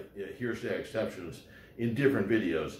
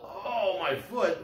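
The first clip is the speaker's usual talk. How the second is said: retold yell; 8 semitones higher, slower than usual. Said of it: "heard him yell".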